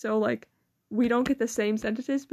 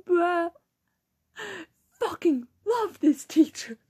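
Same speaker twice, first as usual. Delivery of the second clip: ordinary delivery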